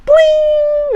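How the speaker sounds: som agudíssimo, alto e prolongado; plim imitado. Acted. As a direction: imite um toque musical bem agudo. (plim agudo e esticado)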